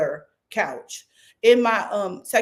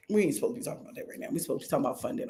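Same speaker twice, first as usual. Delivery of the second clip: muttered aside; 5 semitones lower, 9 dB quieter, much faster than usual. Real, not acted. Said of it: "aside to self, off topic".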